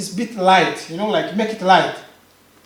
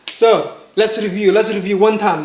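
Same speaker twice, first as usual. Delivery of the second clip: ordinary talk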